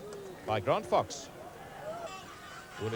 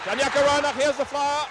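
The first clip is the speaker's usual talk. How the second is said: high, louder burst announcing the runner; spring up at the breakaway; thrilled high call of a flying winger; excited call; 11 semitones higher, 4 dB louder than usual. Real, not acted. Winger in open space.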